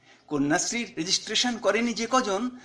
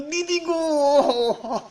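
very slow, drawn-out wail, higher and louder; wailing cry; 6 semitones higher, 4 dB louder, much slower than usual. Acted. Mother crying out to the teacher, breaking into a wail. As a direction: stretch the words into a sobbing wail.

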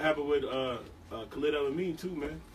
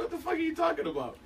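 high-pitched, softer incredulous quote; baffled mock complaint; 10 semitones higher, 5 dB quieter than usual. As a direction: act out puzzled disbelief in a high voice. Retold baffled reaction in couple's exchange.

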